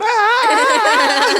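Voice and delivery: long, loud, high-pitched scream; re-enacted scream. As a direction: scream through a fast spinning dive. Acts out scream in fast spin.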